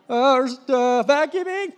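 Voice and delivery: high, loud, slow stalling; flustered excuse. Retold or imitated. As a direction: make it up as you go. Kid stalls for a cover story.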